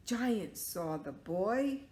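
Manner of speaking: ordinary delivery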